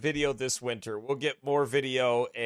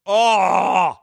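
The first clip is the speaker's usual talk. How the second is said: loud, drawn-out "oh" of relish; savoring, high, loud moan; delighted groan; 4 semitones higher, 10 dB louder than usual. Real, not acted. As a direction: groan loudly over remembered dessert. Gushing over food in photos.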